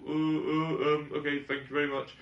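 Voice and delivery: flustered, hesitant British politeness; awkward politeness. Retold or imitated. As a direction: stammer a polite thanks, rushed at the end. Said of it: "I had to go".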